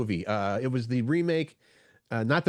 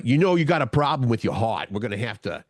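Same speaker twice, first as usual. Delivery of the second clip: imitating a New York doctor's accent; mock New York accent, dropped r's; thick New York accent; 2 semitones lower than usual. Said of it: "he had that really thick New York accent".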